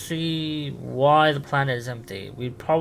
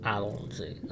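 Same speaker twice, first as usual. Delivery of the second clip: much softer, half-whispered to chat; quiet aside; 3 semitones lower, 10 dB quieter than usual. Real, not acted. Streamer tells chat about the mix-up, hushed aside. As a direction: mutter an aside to the audience.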